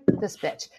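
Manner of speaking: breathy dismissive whisper; whispered scorn. Retold or imitated. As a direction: whisper it with contempt. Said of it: "they're just like".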